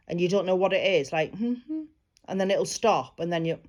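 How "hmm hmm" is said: short soft hums; imitated humming. Shown as a demonstration. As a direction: hum twice like a strange noise. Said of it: "the only way I can describe it is, like, a humming".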